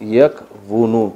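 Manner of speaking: یکونو said very slowly with the w sound kept; slow teaching pronunciation of یکونو; slow drawn-out yakwunu. Shown as a demonstration. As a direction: stretch out یکونو, keeping the kw sound. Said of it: "یکونو تھا یکونو".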